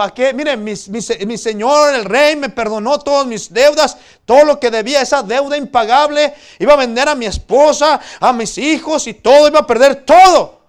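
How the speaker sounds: voz alta que crece hasta el grito; exaltación actuada. Acted. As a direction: proclama exaltado todo lo que ibas a perder. Siervo perdonado cuenta su alivio. (exclamación dramatizada in crescendo)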